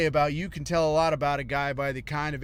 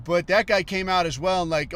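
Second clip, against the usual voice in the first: ordinary talk, nothing marked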